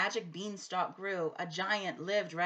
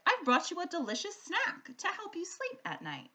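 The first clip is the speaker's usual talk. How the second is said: put-on little girl; 9 semitones higher than usual. A narrator's reading. Sophie offering the snack.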